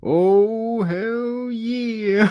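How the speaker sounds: long-drawn, high-pitched cheer; gleeful drawn-out agreement; hyped agreement. Real, not acted. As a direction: stretch it out high with relish. Enthusiastic agreement with co-host's reaction.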